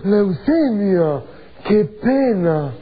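slow dismayed sigh. Acted. Dismayed reply to grave request.

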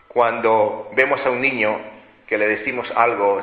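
normal talking voice

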